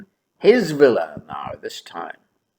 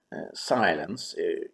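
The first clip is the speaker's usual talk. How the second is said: ordinary delivery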